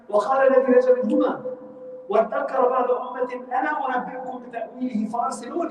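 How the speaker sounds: rapid, lifted Quran recitation. Acted. Quran recitation, fast, high.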